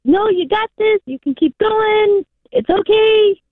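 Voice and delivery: high, lilting reassurance; acted-out encouragement, stretched and high-pitched; encouraging pep talk. Voices the encouragement she needs.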